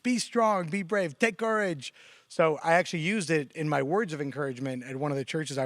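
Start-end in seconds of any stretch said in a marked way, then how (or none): 0.0-1.9 high-pitched campus pep call; imitating a student cheering everybody on; rallying cry. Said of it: "pick everybody up by just running around campus".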